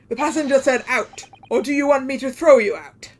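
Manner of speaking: loud, commanding order to leave; angry threat. Acted. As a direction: threaten to throw them out, loudly. Angry character throws intruders out.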